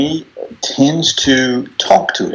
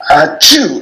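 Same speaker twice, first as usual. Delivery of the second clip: loud, high mock achoo; hammed-up fake sneeze; imitated sneeze; 8 semitones higher, 5 dB louder than usual. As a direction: act out a big explosive sneeze for laughs. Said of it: "I'm going to try to simulate this".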